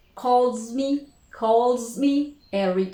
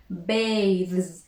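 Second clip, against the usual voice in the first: usual speaking voice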